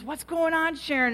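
concerned friend. Retold or imitated. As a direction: imitate a friend asking what's wrong. Friend turns round, asks her.

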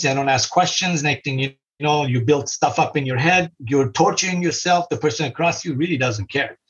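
ordinary talk, nothing marked

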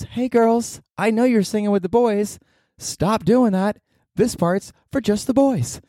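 high, slow singer's scolding. Retold or imitated. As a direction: tell them off in a high drawn-out voice. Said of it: "so she scolded the girls".

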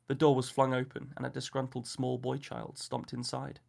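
plain reading voice

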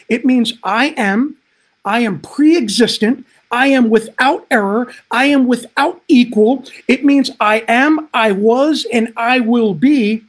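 loud, rising sermon proclamation; preaching at full force. Acted. Preacher proclaims God's name loudly.

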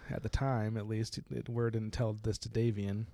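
usual speaking voice

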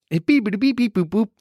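high playful beeping; beep boop noises. Acted. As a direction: beep it out like a phone. Phone call hang-up noises.

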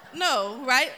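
usual speaking voice

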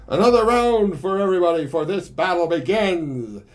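high, loud and fast bar shout; rowdy yell. Acted. Pigsy shouting to the bar, loud rowdy yell. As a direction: roar it over the whole bar. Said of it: "Pigsy yelled out".

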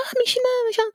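very high, delighted call of a name; friendly greeting. Retold or imitated. Friend spotting her at the counter, very high delighted call. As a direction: sing out a happy hello across the shop. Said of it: "elle fait".

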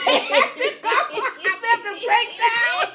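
high laughing voice. Real, not acted. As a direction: laugh through the whole line. Laughing about breaking down.

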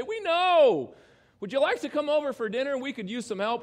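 high, loud, quickening plea; eager shouted admission. Acted. Sinners admitting it to Jesus, loud eager reply. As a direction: holler back in ready agreement.

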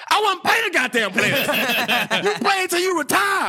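high, shouted outburst with laughs; mock coach rant. Laughing storyteller playing the old coach, shouting high. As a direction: yell it way up high, cracking up. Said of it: "the guy in the corner like".

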